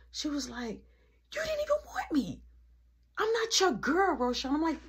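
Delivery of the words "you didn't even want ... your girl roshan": very high mock complaint; imitating upset girlfriend. Kiki telling him she's not his girl, high imitated upset. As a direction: pitch it way up like a hurt girlfriend.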